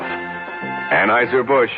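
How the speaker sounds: ordinary delivery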